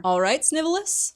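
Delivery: high, loud sing-song jeer; mocking taunt. James jeering at Snape across the lawn, high mocking taunt. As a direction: sneer the nickname up high.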